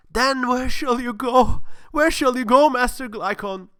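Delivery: eager worshipper's questioning; gullible questioner. Acted. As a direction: beg the god for an answer. Believer questions the god.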